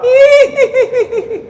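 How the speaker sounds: very high, shrill ghostly giggle; loud, high-pitched spooky tittering; graveyard ghost laugh. Retold or imitated. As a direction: imitate a movie ghost's cackle. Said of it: "ada suara".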